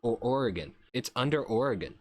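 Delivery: ordinary talk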